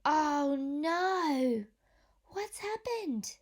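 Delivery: a storyteller's playful dismay; mock alarm. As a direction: act shocked for the children. Storybook surprise moment.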